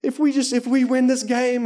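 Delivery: high, amateur British football fan; hammed-up British accent; British accent attempt. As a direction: badly imitate an emotional British fan. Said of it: "I'm gonna do my British accent".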